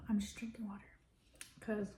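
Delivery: whispering. Real, not acted. Whispered aside.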